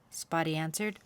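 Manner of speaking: ordinary delivery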